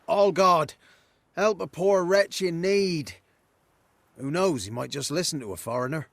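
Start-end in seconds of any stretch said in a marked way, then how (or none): 0.1-3.2 loud, high, pleading call; a poor wretch calling his god; crying out to a god. Shown as a demonstration. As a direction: cry out to the heavens for help. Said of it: "cry out".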